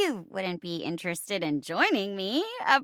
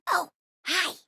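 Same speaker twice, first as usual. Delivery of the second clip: usual speaking voice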